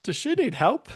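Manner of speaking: high, squeaky mock-concerned voice; high mock worry. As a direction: play it up as fake worried. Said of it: "Manaki and Kyle are like".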